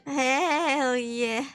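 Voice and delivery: ordinary talk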